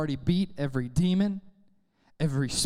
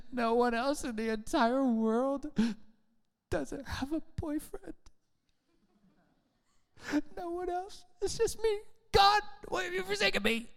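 high, put-on woe building higher; mock weepy self-pity; 7 semitones higher, 6 dB quieter than usual. Believer crying to God over having no boyfriend, mock-tragic. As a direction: wail softly like a melodramatic martyr.

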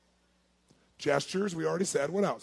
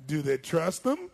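slow, drawn-out delivery; slow talker; slower than usual. Shown as a demonstration. Coach voicing slow talkers who distrust city folk, slow-talking. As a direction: stretch the words like a slow talker.

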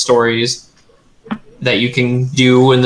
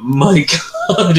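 laughing; 5 semitones higher than usual. Real, not acted. Laughs while stressing.

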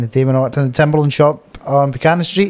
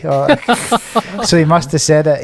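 ordinary talk, nothing marked

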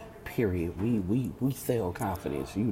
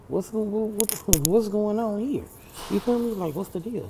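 baffled outburst; 10 semitones higher, 6 dB louder than usual. Retold or imitated. Retelling confusion over missing call.

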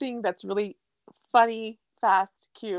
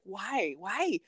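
mock exasperated complaint; 6 semitones higher than usual. Complaining about low toilet paper dispenser.

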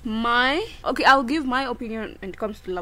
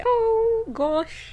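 giddy; 11 semitones higher than usual. Real, not acted. Remembering her date.

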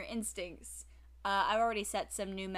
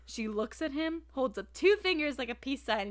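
ordinary delivery

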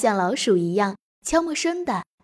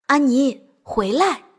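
loud, urgent call; shouted call; 3 semitones higher, 5 dB louder, slower than usual. A narrator's reading. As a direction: call her back from a climb. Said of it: "杰克又喊了一声". (大声喊人回来)

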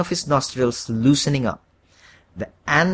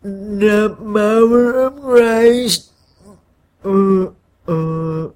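slow, higher, congested stammer; stuffy-nosed stammer; 8 semitones higher, 5 dB louder, much slower than usual. A narrator's reading. Scared man stammers exorcism lines at his horned double, stuffy-nosed. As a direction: stumble through it with a blocked nose. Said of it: "and stammered".

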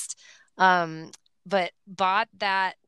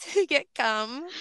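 usual speaking voice

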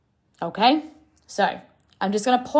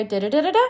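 nonsense syllables rising at the end like a question; rising intonation; 3 semitones higher, much faster than usual. Shown as a demonstration. Coach mimicking women's rising sentence endings, questioning upturn. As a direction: let the last "da" lift into a question. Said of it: "when you rise at the end of the sentence".

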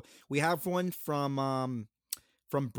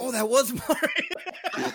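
laughing realization; 9 semitones higher, much slower than usual. Real, not acted. Realizing it was Mark.

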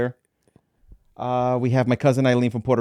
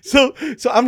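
laughing; 12 semitones higher, 3 dB louder than usual. Real, not acted. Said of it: "listen for your laugh".

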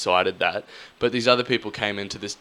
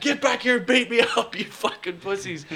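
high yelling taunt; 12 semitones higher than usual. Retold or imitated. After the departing rugby boys, a high furious yell. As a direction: yell after them like a madman.

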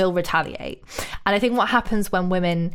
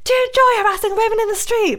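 much higher, louder and fast; shrieked retort; 14 semitones higher, 4 dB louder, faster than usual. Retold or imitated. Cyclist screaming back at a harasser, shrill and fast. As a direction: scream it back to shame him. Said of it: "I screamed at him".

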